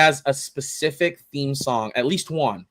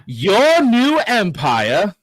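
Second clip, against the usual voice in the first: incredulous; 7 semitones higher, 5 dB louder, much slower than usual. Acted. Obi-Wan retort.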